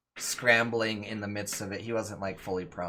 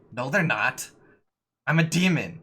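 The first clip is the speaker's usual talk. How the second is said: loud, higher, insistent fake-demon protest; hammed-up menace from a would-be demon; Greg; 5 semitones higher, 9 dB louder than usual. Game master voices a pretend demon insisting he is real, hammed-up menace. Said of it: "and Greg's like".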